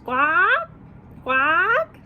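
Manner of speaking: put-on high, loud duck quack for the kids; playful duck noises. Shown as a demonstration. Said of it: "And what do they say?".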